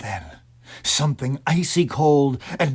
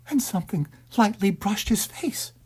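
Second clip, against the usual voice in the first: usual speaking voice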